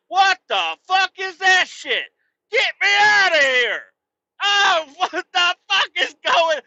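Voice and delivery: high, loud disgusted yell; outraged shouting. Real, not acted. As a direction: shout to get out of there. Ranting about gum wall.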